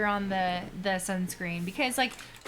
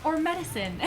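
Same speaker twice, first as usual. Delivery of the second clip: quiz question; 8 semitones higher than usual. Retold or imitated. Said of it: "they're like".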